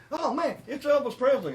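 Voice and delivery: high, excited gush; starstruck. Retold or imitated. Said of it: "and he goes".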